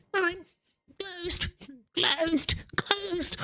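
tiny, very high, soft voice; mock accomplice voice. Imitating the best mate running round the doors, soft high squeak. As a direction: squeak it softly, word by word. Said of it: "just running all the doors going".

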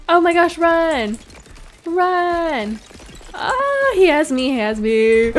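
high, loud frightened cries; panicked yelling. Real, not acted. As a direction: cry out caught by the giant. Giant grabs her.

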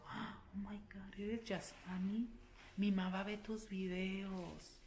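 hushed, slow whisper of a starstruck clerk; quietly. Retold or imitated. Clerk quietly recognizing the YouTuber, slow hushed whisper. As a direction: whisper it slowly like a secret fan. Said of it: "me dice así bajito".

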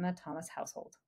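soft whispered final word; whispered aside. Real, not acted. Mom naming the family household, dropping to a whisper. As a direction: drop to a whisper on the last word.

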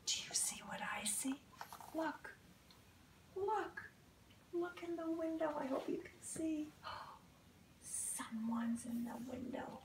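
hushed, secretive storytime whisper; soft whisper pointing at the window; suspense whisper. Acted. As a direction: draw the kids in with a hush. Reader whispers to children, suspense.

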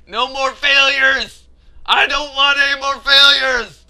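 loud, insistent rallying yell; determined shout. Real, not acted.

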